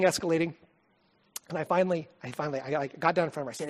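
usual speaking voice

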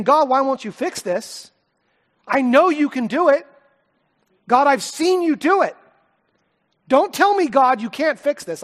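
slow, loud, high pleading cry to God; yelling at God. Retold or imitated. Father retells yelling at God over his sick daughter, loud and slow. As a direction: demand that God fix it, slowly and loudly. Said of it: "I'm yelling at God".

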